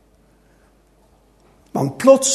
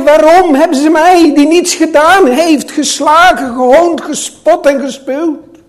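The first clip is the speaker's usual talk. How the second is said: loud, higher indignant outcry; ringing protest of an innocent man; crying out in protest; 4 semitones higher, 6 dB louder than usual. Acted. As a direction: demand to know why, voice raised. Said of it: "Riep hij".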